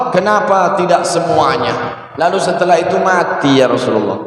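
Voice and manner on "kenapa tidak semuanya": normal talking voice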